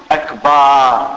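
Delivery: أكبر with a long final vowel, "akbaar"; long alif in akbar. Shown as a demonstration. Teacher showing the mistake to students, أكبر lengthened to "akbaar". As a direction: hold the "aa" between ب and ر in أكبر. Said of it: "بعد الباء وقبل الراء".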